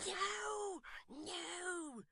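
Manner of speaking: hissed urgent no, very soft; hushed cry. Acted. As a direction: shush her from the street. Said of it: "cried Mister Tappertit".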